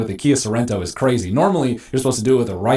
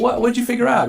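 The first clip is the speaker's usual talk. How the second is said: curious family; 12 semitones higher than usual. Pretend. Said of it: "And they go".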